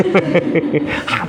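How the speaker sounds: short amused laugh; quick chuckle; real laugh. Real, not acted. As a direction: laugh at the student's flattery. Laughs at student's flattery.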